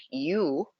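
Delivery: usual speaking voice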